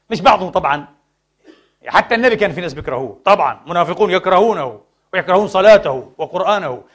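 usual speaking voice

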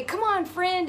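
put-on high, loud kid calling a friend; excited little girl. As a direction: call out eagerly like a child to a playmate. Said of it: "we would say".

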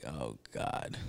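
deep, low mock groan of dismay; waiter's groan. Waiter reacting to a customer who cannot pay, deep mock groan. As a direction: drop way down for a horrified Oh God. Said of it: "He was like".

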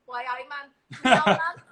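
a mild Geordie twang on "Why, I man"; Geordie phrase. Pretend. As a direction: owner voicing the Geordie cat, a light Geordie twang. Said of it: "instead of".

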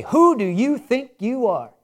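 slow, accusing "Who do you think you are?" with WHO hit hard, like a put-down; scornful challenge. Shown as a demonstration. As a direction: throw it at someone as a reproach. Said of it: "Now, I could say".

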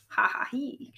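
light acted "ha ha hee"; read-aloud chuckle. A narrator's reading. Robot chuckles at Ribbit, a light acted laugh. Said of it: "chuckled Robot".

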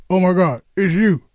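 high-pitched gushing caller, lightly hammed up; mock starstruck fan. Host mocks callers who keep them waiting, mock starstruck. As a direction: imitate a fan who finally got through. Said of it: "for people to go".

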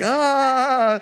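a sudden high, loud yelp of horror; horrified ah. Retold or imitated. Recalling the mistaken kiss, a high loud horrified yelp. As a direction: cry out in shocked embarrassment. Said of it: "I was just horrified".

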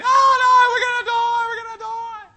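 piercing high, very loud cartoon wail; Homer panic shriek. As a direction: scream it like Homer doomed in the fog. Homer panics as fog returns.

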